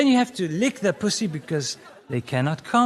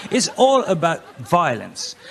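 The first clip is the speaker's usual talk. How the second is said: ordinary delivery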